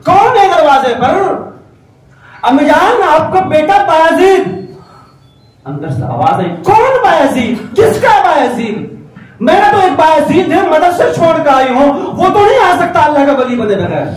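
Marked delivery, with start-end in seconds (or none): 0.0-1.3 high, loud sharp call through the door; a mother's sharp demand from inside. Acted. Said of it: "بڑے تیکھے لہجے میں آواز آئی". 2.4-4.7 1 calling out. Acted. Boy calls at mother's door. 6.5-14.1 a mother turning away her own son; stern mother. Acted. Mother refuses son at door.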